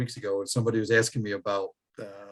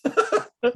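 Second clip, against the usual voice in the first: a short high burst of laughter; retold laugh. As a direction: laugh him off, amused. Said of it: "and I laughed at him".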